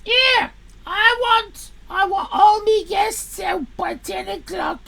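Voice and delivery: loud barked landlord, drawn out at the start; gruff landlord voice. Acted. Landlord ordering the guests out by ten, loud. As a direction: play the angry landlord throwing out guests.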